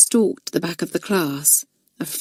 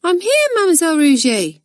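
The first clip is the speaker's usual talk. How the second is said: schoolgirl voice; 11 semitones higher, 4 dB louder, faster than usual. Acted. Schoolgirl answers from hiding.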